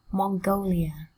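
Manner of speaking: usual speaking voice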